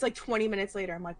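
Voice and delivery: ordinary delivery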